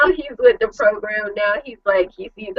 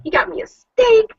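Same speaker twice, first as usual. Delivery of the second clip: much higher, gleeful anticipation; mock-giddy excitement, pitched up; excited; 11 semitones higher than usual. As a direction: squeal over the steak you think you got. Retold excitement over steak.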